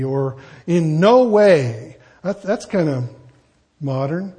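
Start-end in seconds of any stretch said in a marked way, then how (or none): 0.7-2.0 "in no way" drawn out very slowly and louder; slow emphatic. Shown as a demonstration. Preacher reciting a translation slowly for emphasis. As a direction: stretch each word of "in no way". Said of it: "Your translation might start with".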